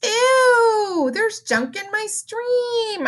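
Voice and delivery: high, slow mock disgust; sarcastic disgust. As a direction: fake horror at the mess. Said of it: "I'm hoping you're getting my sarcasm".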